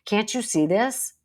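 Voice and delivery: hammed-up bossy coworker; mock bossy. Shown as a demonstration. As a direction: lay down the law to the team. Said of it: "you didn't say".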